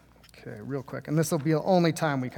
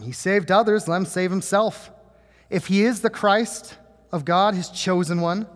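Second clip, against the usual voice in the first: a bit higher and louder, a lightly acted sneer; mocking scoff; 5 semitones higher, 6 dB louder than usual. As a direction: read the rulers' taunt with mild scorn. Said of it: "the rulers scoffed".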